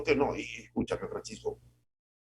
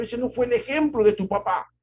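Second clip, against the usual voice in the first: much higher and louder, a scolding parent; reproaching mother; 12 semitones higher, 7 dB louder than usual. Retold or imitated. A mother scolding her son for not following his father. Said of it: "nos han dicho".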